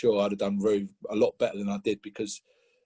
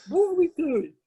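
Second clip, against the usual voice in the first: very high, louder incredulous question; mock exasperated wail; 6 dB louder than usual. Player questioning the endless running, retold as an exasperated high wail. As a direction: squeal it in mock disbelief.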